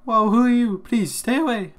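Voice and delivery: high, loud startled fear; frightened alarm. Acted. Frightened boy warns a stranger off, high alarmed voice. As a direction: recoil in alarm, pleading at high pitch.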